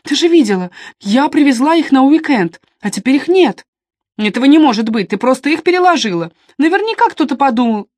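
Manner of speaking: worked up over something missing; agitated. A narrator's reading. Upset quarrel over missing cameras.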